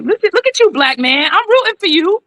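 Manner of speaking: high-pitched, sing-out encouragement; playful cheering. Acted. Acted cheering on of the guest.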